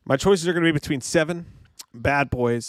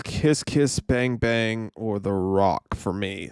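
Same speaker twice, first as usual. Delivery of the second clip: ordinary talk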